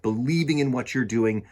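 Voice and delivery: ordinary delivery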